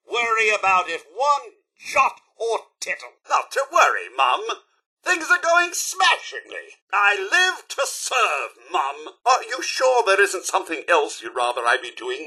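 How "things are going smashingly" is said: high, strained brightness; forced cheer. Acted. Unhappy companion reassuring Mum, forced cheer. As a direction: insist all is well without believing it.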